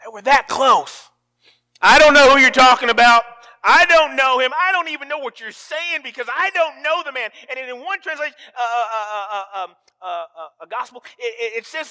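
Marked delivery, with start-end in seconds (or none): 1.8-7.3 loud, high desperate denial; Peter's denial. Acted. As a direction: deny it loudly, cornered and scared.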